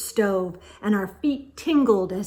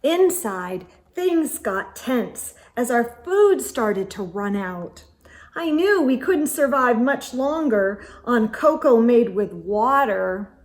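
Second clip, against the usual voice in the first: plain reading voice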